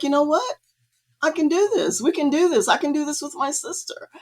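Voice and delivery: high-pitched, dawning can-do excitement; retold resolve. Retelling her sudden resolve to paint.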